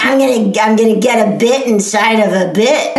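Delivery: lightly voiced horny old woman; a hint of a sultry granny; weird sex voice. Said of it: "this voice of [name]'s".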